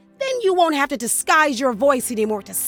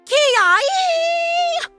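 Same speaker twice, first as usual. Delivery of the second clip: battle cry; 12 semitones higher than usual. Acted. Battle cry before attacking.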